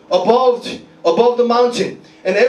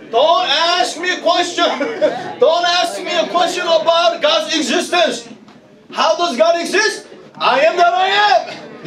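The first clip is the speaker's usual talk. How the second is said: raised, insistent pulpit declaration; fervent preaching; 6 semitones higher than usual. Real, not acted. Preacher refusing questions on God's existence, fervent preaching. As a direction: preach it with high-pitched conviction.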